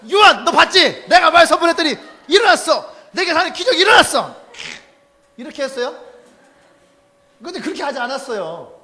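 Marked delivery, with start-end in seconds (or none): 0.1-4.4 much louder, higher gloating yell; mock boastful shout. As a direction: yell it at John like a show-off. Peter shouting to John.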